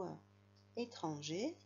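ordinary talk, nothing marked

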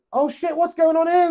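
sudden high yelp of alarm; jumping at a loud sound effect; startled. Real, not acted. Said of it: "that scared the bejeebus out of me".

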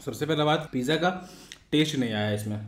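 chewing between words; talking around food; mouth partly full. Real, not acted. Seen 1: Bit pizza at -5; cheeks puffed, chewing between words till 3.4.

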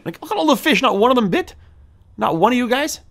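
very high, incredulous complaint; high-pitched disbelief. Real, not acted. Player complaining to fish that none bit, high-pitched disbelief.

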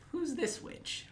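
high, soft squeaky question; mock puzzled. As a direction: ask in a baffled little squeak. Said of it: "I was like".

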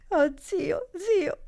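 put-on high, crying child's cry; bursting into tears. A narrator's reading. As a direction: break down sobbing as the girl. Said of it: "proruppe la bambina, portandosi ... il fazzoletto agli occhi". (grido di pianto della bambina)

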